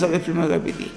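ordinary talk

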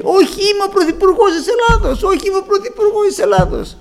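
high, loud, wild insistence; patient in an asylum, shouting her delusion in a high wild voice; manic shouting. Retold or imitated. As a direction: shout it like you are dancing on the bed. Patient dancing on bed, delusional.

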